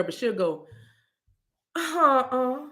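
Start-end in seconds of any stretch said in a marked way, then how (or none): 1.8-2.7 imitating sister's refusal. Host shows how her sister disapproves, imitated uh-uh. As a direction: imitate a sister's long wordless no. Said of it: "My sister likes to say, now I can't do it like her".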